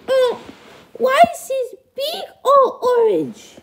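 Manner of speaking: very high falsetto questioning; high-pitched puppet parent; duckling's parent, baffled. Acted. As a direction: puzzle it out in a squeaky high voice.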